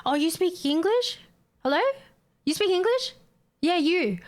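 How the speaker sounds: high mock-patronizing questions, each rising; condescending customer. Rude customers coming up at work, condescending mock questions. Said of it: "it's always the same thing".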